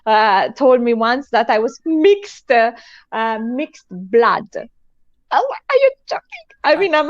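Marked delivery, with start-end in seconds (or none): 5.3-6.2 very high-pitched mock disbelief; mock incredulous. Reacting to being called mixed blood, mock incredulous. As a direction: squeal it as a retold indignant comeback.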